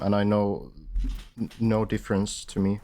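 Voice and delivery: ordinary delivery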